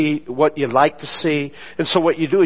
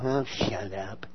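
low, very soft, slow "shut up"; a tired brush-off to a coworker; grumbled retort; 7 semitones lower, 12 dB quieter, slower than usual. Retold or imitated. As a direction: mutter it off under the breath. Snapping at June.

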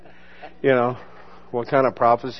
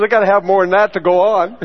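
laughing complaint; 5 semitones higher, 5 dB louder than usual. Retold or imitated.

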